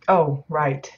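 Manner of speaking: ordinary talk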